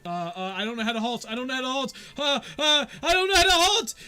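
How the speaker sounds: panicked. Acted. Yorn panicking as the wagon rolls at guards. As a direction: build from fluster to full panic. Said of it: "And he's just like".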